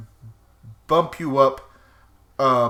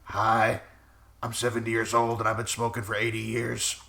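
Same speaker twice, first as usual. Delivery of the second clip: put-on old smoker aunt; 3 semitones lower, 5 dB quieter than usual. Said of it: "her imitation of my aunt is".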